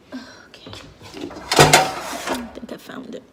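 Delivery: murmured to herself in the kitchen; whisper. Real, not acted. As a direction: breathe it out under her breath. Whispering while searching pans.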